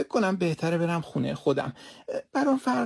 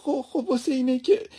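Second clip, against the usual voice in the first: higher, stammering through sobs; sobbing stammer; 6 semitones higher, 3 dB louder than usual. Acted. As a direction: choke on the words while crying.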